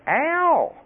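very high, stretched comic yelp; mock cry of pain. Preacher smarting from God's words, hammed-up high ow. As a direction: wail it as if the rebuke hurt.

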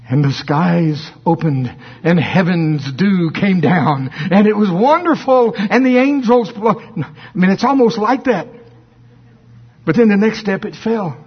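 ordinary talk

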